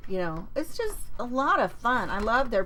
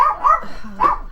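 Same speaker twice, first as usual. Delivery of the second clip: loud, high exasperated sigh at the dog; 10 dB louder than usual. Real, not acted. Host sighing at her barking dog mid-stream, loud. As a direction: let out a big frustrated sigh.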